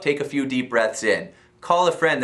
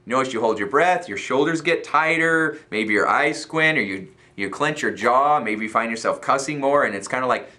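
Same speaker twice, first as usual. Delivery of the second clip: ordinary talk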